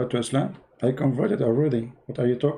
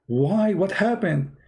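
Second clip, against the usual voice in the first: pleading son; 7 semitones higher, much slower than usual. Retold or imitated. Son demanding reason.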